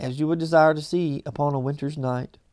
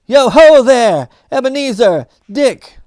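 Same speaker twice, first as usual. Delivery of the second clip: jovial fat voice; 7 semitones higher, 12 dB louder than usual. A narrator's reading. Fezziwig calling his apprentices, jovial booming call. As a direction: boom it out like a jolly old master. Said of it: "called out in a comfortably oily, rich, fat, jovial voice".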